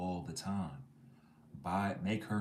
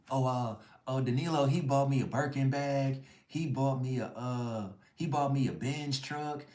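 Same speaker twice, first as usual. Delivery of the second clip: higher, louder mock girly brag; bragging girlfriend; 6 semitones higher, 4 dB louder than usual. Voicing Aaliyah bragging to her friends, high mock girly voice. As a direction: gush over the gifts like a show-off.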